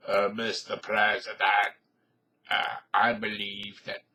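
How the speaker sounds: a hint of a deep diplomat; Henry Kissinger voice. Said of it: "I can't do a Henry Kissinger impression".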